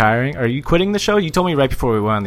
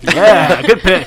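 sarcastic; 7 semitones higher, 5 dB louder, slower than usual. Retold or imitated. Mocking reaction.

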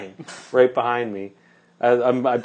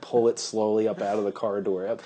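usual speaking voice

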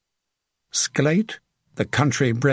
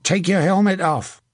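raised-pitch soldier's command; firm order; 6 semitones higher than usual. A narrator's reading. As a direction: order an enemy to obey. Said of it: "he ordered the man".

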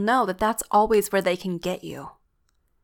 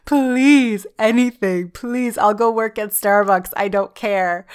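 louder, frantic begging in her head; desperate loud plea; 6 dB louder than usual. Retold or imitated. Pleading loudly with God to leave. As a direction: beg loudly to get out of the job. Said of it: "I'm like".

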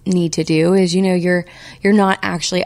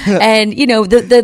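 ordinary delivery

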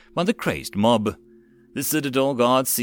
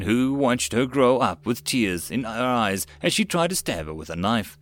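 plain reading voice

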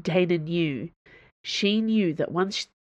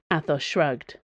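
ordinary delivery

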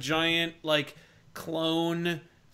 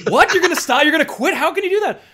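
shocked outburst; 11 semitones higher, 11 dB louder, faster than usual. Retold or imitated. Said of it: "And he goes".

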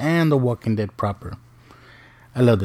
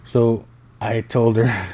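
usual speaking voice

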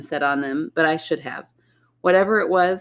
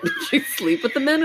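judgy mock voice; 10 semitones higher than usual. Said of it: "I thought you were gonna be like".

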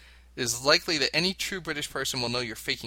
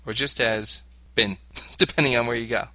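ordinary talk, nothing marked

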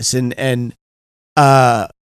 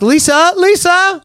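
high, plaintive calling of a name; imitated near-tears call; slower than usual. Upset husband calling wife in next room, plaintive high call. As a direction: call out in mock distress, climbing higher. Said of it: "I was almost in tears".

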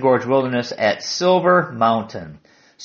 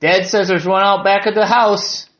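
lightly voiced young girl, pitched up; little girl's voice; 5 semitones higher, 4 dB louder than usual. Girl tells hiker about a bear, lightly voiced child. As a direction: give a hint of an insistent small child.

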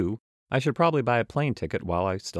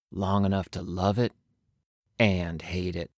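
cool traveler's voice. Acted. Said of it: "I do need to change voices".

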